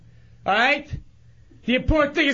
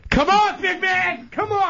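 taunting yell; 8 semitones higher than usual. Real, not acted. Chunks taunting the caller on air, yelling. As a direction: goad him into coming down. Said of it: "I just yelling".

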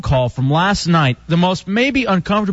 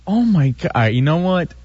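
ordinary talk, nothing marked

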